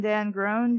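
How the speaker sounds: usual speaking voice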